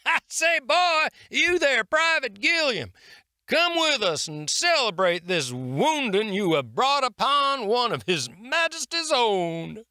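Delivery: a booming, good-humoured sergeant ribbing his friend; jovial sergeant. A narrator's reading. Hornsby's voice in the story.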